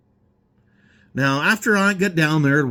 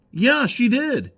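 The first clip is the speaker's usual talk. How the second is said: slow, higher, dry yeah-right; sarcastic; 8 semitones higher, slower than usual. Real, not acted. Answering Facebook posts about stolen money, slow sarcasm. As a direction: drag it out with open sarcasm.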